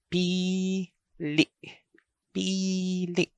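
pili with PI held long, LI cut short; long vowel, then stop. Shown as a demonstration. Teacher showing how the pronunciation goes, pili long then stopped. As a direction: stretch the first vowel of pili, stop the second. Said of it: "The first vowel is prolonged".

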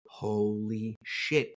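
retold awestruck disbelief; stunned realization. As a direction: let it dawn on you slowly. Acted moment of dawning realisation.

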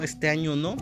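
ordinary delivery

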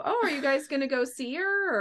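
high-pitched, friendly retold inquiry; chatty friendly question. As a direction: ask it lightly, high in your voice. Retelling friendly question to passenger.

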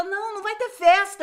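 the mother cutting her off, firm; insistent mother. Retold or imitated.

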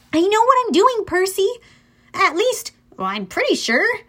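high, insistent voice losing certainty; confident girl hero. A narrator's reading. Annabeth reassures Percy as they pass her, insisting.

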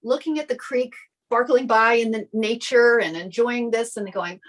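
ordinary delivery

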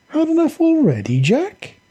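high, mocking sing-song taunt. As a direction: tease him from above. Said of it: "the fallen angel taunted".

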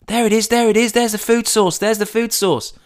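high, eager little chant; can't contain the thrill of finding it; excited fox cubs. Acted. Fox cubs spotting the Chinese food, high and eager.

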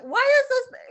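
loud, high disbelieving outburst; incredulous. Retold or imitated. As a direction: shout it in disbelief. Retelling shock at mailing price.

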